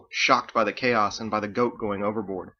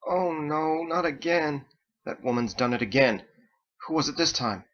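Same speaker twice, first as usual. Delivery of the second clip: weary moan; 5 semitones higher than usual. Acted. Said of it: "The gathering crew moaned".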